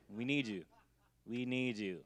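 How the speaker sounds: ordinary talk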